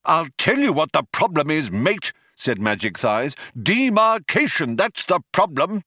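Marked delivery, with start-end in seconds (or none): 0.1-2.1 raised, lightly voiced shop steward; indignant union man. Philosopher explains his grievance to programmer, lightly indignant union man. 3.6-5.8 high-pitched, emphatic grievance; indignant protest. Acted. Philosopher states his complaint to programmer, indignant protest.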